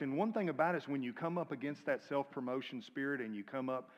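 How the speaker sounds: ordinary delivery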